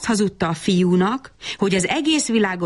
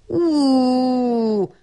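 hooting wail; 8 semitones higher than usual. A narrator's reading. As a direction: let out a long hoot of dismay. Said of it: "tutult Pálinkós Gyurka".

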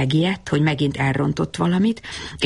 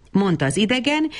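usual speaking voice

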